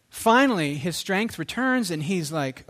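normal talking voice

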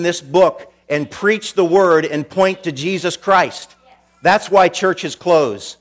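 full-force preaching. Acted. Pastor warning why churches close, full-force preaching.

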